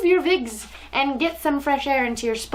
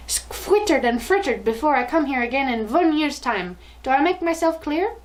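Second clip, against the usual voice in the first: put-on higher, commanding foreign-accented tirade; accented shriek; 4 semitones higher than usual. Grand High Witch gives witches her orders, higher accented shriek. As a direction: bark harsh orders in a thick accent. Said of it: "shrieked the Grand High Witch".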